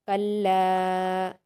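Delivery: "कल्ला" with the final aa held very long; four-count elongation. Shown as a demonstration. Teacher showing a madd of four harakat, long-drawn "कल्ला". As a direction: stretch the last vowel of "कल्ला" out slowly and steadily. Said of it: "चार हरकत के बराबर खींचेंगे".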